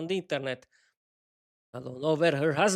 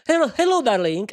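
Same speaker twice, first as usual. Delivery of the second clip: bright greeting; 6 semitones higher, 6 dB louder than usual. Acted. Greeting friend on phone.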